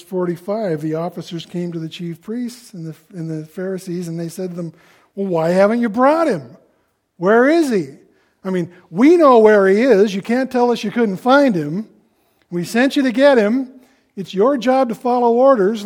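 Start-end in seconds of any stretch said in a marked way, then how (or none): 5.1-15.9 loud, raised-pitch demand for answers; acted indignant boss scolding underlings; indignant interrogation. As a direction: dress down your subordinates, voice high and forceful. Priests angrily question the officers.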